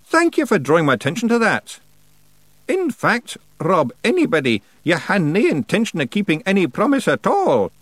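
high, loud character voice; pointed, high-pitched witch cross-examining; interrogating old witch. A narrator's reading. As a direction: press the rogue for an admission. Miss Treason questioning Rob.

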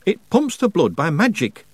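slightly deliberate explanation; speaking slowly. A narrator's reading. Baker explains magic to young witch, speaking slowly. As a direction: spell it out as if obvious. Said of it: "speaking slowly".

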